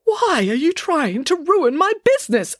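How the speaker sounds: high, indignant protest; aggrieved question. A narrator's reading. Doctor accuses rival of ruining his business, indignant. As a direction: accuse her in a high, upset voice. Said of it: "asked the doctor".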